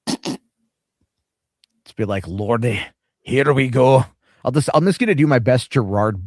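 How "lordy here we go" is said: mock Scottish exclamation; terrible Scottish accent. Shown as a demonstration. Host showing how he would do commentary, fake Scottish accent. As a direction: do a hammed-up bad Scottish accent. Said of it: "terrible Scottish accent".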